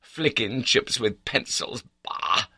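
high-pitched, exasperated tirade. A narrator's reading. Accused baronet explaining himself to his confidant, angry. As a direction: rant in outraged indignation.

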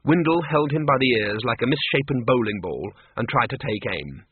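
plain reading voice

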